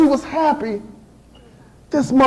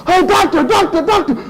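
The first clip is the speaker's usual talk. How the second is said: higher-pitched, hurried plea for help; urgent call; 5 semitones higher than usual. Acted. Patient calling the doctor as labor starts, urgent call.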